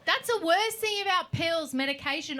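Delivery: ordinary delivery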